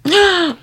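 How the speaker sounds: a sharp, high gasp of surprise; surprised gasp. Real, not acted. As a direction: gasp at the sender's name. Hears who sent the story.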